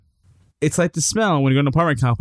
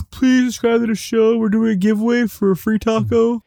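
begging podcaster; 8 semitones higher than usual. Retold or imitated. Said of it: "They usually sound so beggy".

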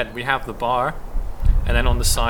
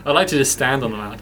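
ordinary delivery

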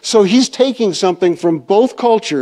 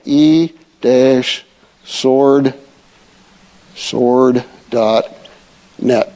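slow, spelled out; 5 semitones lower, much slower than usual. Shown as a demonstration. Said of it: "I'll put the reference here".

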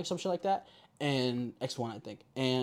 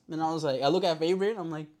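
ordinary talk

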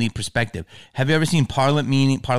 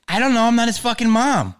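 dismissive retort; 10 semitones higher, 3 dB louder than usual. Pretend. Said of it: "you could just be like this".

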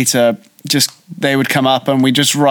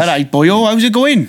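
put-on Welsh greeting; Welsh accent; 6 semitones higher than usual. Shown as a demonstration. Asked by a co-host to show off Welsh, in a Welsh accent. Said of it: "I want you to do Welsh".

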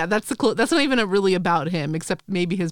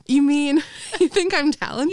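mock hopeful; 7 semitones higher, 3 dB louder than usual. Repeating Jessica's hopeful line.

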